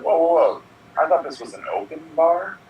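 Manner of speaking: high startled protest; protest, shocked; indignant. Pretend. Indignant guest quote.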